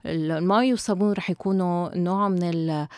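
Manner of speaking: ordinary delivery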